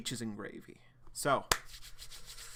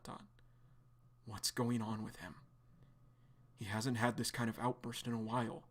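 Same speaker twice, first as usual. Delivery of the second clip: ordinary delivery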